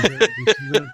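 high giggly question; laughing. Real, not acted. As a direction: laugh through the words.

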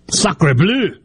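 put-on French accent. Mock French reaction to news.

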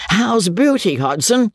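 high, jeering question; a touch of a sneering squire; mocking old baronet. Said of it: "Sir Pitt jeering at brother".

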